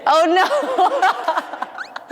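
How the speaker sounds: high laughing oh no. Real, not acted. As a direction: giggle through it. Laughing at the cramp story.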